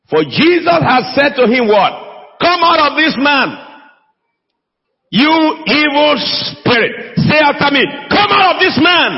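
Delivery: high, impassioned preacher's cry; full-force preaching. Real, not acted. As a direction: proclaim it to the congregation with rising intensity.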